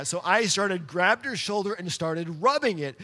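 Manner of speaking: usual speaking voice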